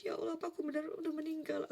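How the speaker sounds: frightened dreamer asking God, teary; tearful question. Retold or imitated. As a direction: plead on the edge of tears. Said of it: "Sampai aku nangis-nangis".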